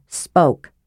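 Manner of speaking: plain reading voice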